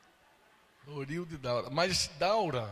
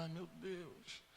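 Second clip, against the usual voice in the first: a much softer, slow, breathless "ai, meu Deus"; overcome sigh; 14 dB quieter, much slower than usual. Real, not acted. The preacher overcome as everyone cries, hushed and slow. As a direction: barely get the words out, overwhelmed.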